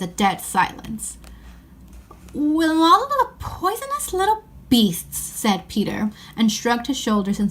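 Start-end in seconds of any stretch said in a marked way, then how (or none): 2.3-5.2 disgusted. Acted. Peter disgusted at Edmund.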